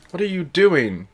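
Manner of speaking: high, indignant outburst; spitting outrage. A narrator's reading. Hermione to the hat after the unwanted kiss, spitting outrage. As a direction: spit out the question in outrage. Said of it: "she spat".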